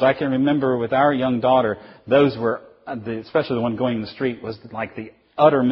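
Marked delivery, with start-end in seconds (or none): none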